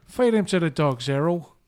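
mock London crime boss giving an order; Bricktop. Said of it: "I just do bricktop".

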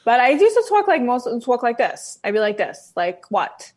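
put-on demonstrating a combative old Queens accent. Shown as a demonstration. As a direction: talk like a Queens local ready to argue. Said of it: "combative, uh, Queens accent".

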